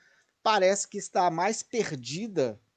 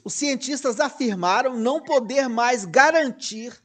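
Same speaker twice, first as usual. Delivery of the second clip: high, emphatic reading; slightly carried away, pitched up; a little worked up; 5 semitones higher, 4 dB louder than usual. Real, not acted. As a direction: read it out with rising indignation, hitting "garantir". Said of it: "Eu me exaltei um pouquinho".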